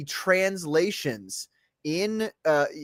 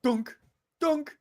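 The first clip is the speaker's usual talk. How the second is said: high-pitched spoken "dunk"; mock mic-drop thud; 9 semitones higher, 4 dB quieter than usual. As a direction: voice the mic hitting the floor. Imitates the dropped mic landing.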